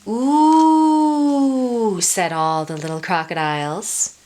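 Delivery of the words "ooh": high, loud drawn-out ooh of wonder; awed ooh. Acted. Little crocodiles marvel at the boat, a loud high ooh. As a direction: marvel at the sight.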